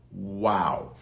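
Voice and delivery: ordinary delivery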